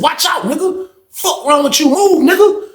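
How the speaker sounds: high, heated jail-cell yell; angry yell. Retold or imitated. In the cell, telling the guy to move: heated yell. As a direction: bark it at him to move out the way.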